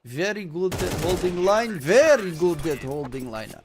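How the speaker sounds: hammed-up Russian accent; fake Russian accent. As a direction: put on a Russian accent, emphatically. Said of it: "why I put fake Russian accent".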